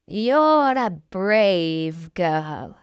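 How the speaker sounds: low, very slow beast voice; the beast slowly praising Beauty, low and heavy. A narrator's reading.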